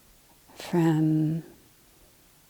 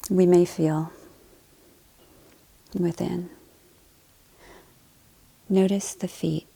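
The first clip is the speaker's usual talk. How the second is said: calm body-scan voice, short phrases between long silences; guided meditation; 4 dB quieter than usual. Acted. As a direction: lead them gently through the body, leaving space to breathe.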